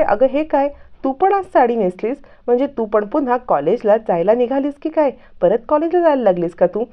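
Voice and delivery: playfully astonished at the sari; surprised young woman. A narrator's reading.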